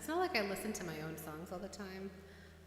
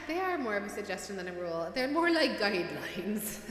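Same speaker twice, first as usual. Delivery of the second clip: hammed-up fake Irish brogue, played for laughs; bad Irish accent; 10 dB louder than usual. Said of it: "in a really bad Irish accent".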